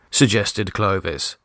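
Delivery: plain reading voice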